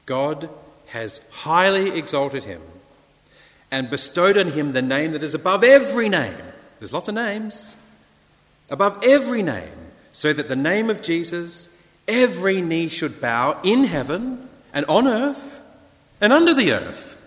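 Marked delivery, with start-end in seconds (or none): 0.1-6.4 emphatic scripture proclamation. Real, not acted. Proclaims scripture with rising force. 8.7-17.2 high, loud preaching crescendo; emphatic scripture proclamation. Real, not acted. Proclaims scripture at full force.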